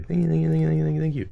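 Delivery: high, rapid stream of thank-yous; squealy repeated thank you; gushing thanks. Real, not acted. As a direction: pour out thanks in a high excited voice.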